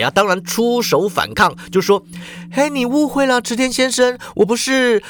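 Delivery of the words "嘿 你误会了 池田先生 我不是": high-pitched hurried denial; flustered protest. A narrator's reading. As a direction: try to clear up a misunderstanding. Protesting while being groped. (慌忙辩解)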